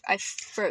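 "flew" said with an F sound for "threw". Shown as a demonstration. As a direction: say "flew" with F where TH should be. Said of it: "I say it with an F instead".

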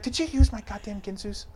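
high-pitched mock-annoyed wife; mad wife. Wife to husband about the good knives, mock mad. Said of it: "she gets mad about that shit".

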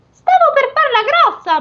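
high, far-off ogre voice. Acted. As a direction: ham up the drunken ogre. Orco voiced in fairy tale.

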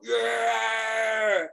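loud, high whoop of an entrance; party yell. Retold or imitated. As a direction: burst in whooping to hype the room. Bad Santa bursting into party.

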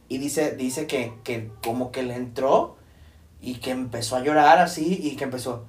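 ordinary talk, nothing marked

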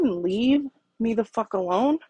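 normal talking voice

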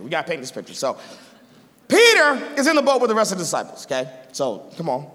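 ordinary talk, nothing marked

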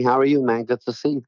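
normal talking voice

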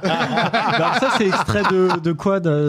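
real laughter running into the words; laughing. Real, not acted. Player asking where the extract came from, laughing. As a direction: try to ask while cracking up. Cracks up over the answer.

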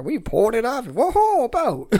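high, amazed whoa on boat; mock surprise. Mimicking the fisherman's story of finding the boat, high mock amazement. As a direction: imitate the old man's wide-eyed find. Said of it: "you always kept ... repeated it".

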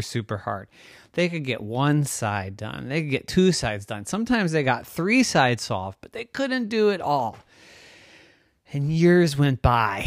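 plain reading voice

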